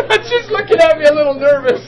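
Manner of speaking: high, loud narration through laughter; laughing. Real, not acted. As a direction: keep telling it while laughing. Still laughing while narrating.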